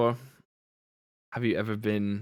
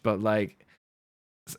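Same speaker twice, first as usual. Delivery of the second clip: ordinary delivery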